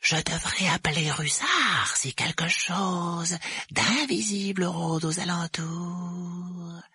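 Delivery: high, very slow creepy voice; drawn-out eerie taunt; menacing poltergeist. A narrator's reading. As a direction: threaten to tell, stretching the words out.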